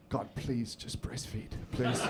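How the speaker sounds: soft, slow, pleading under the breath; quiet inner plea. Retold or imitated. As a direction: murmur a hopeful prayer. His silent inner plea.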